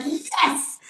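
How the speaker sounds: high, delighted cheer; secret triumph. Retold or imitated. After hanging up the phone, a high delighted cheer. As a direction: celebrate behind her back. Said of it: "I'm like".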